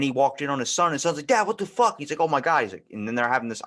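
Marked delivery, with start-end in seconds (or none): 1.3-2.0 high, loud outraged cry; startled yell. Retold or imitated. Son caught by his father, shocked high yell. 2.2-2.6 mild retold shock. Father walking in.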